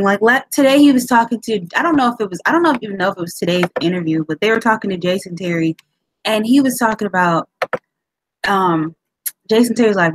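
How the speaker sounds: ordinary delivery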